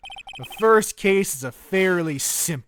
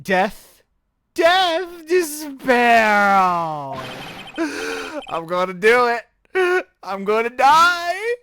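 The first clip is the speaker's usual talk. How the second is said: hammed-up despair; 5 semitones higher, slower than usual. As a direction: wail about dying, hammed up. Client despairs.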